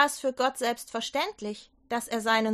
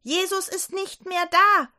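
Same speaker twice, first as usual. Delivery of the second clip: bursting out; 8 semitones higher, 4 dB louder than usual. Acted. Maria bursts in on the grieving disciples, loud and excited. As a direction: blurt it out, overwhelmed. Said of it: "rief Maria".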